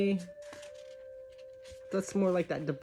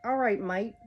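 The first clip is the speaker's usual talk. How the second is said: a light stab at Australian; badly faked Australian greeting; attempted Australian accent. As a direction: try a playful, hesitant Australian accent. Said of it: "I'm trying to do Australian".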